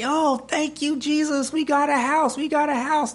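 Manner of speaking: imitating overjoyed children in high voice; very high, celebrating child voice; happy kids. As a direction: squeal it like thrilled little kids. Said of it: "running around all happy".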